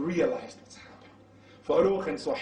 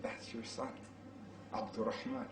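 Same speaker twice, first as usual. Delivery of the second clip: very soft and low; hushed revelation; 4 semitones lower, 14 dB quieter than usual. Retold or imitated. Revealing to the father who his son is, hushed. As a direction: let the news out gently, barely voiced.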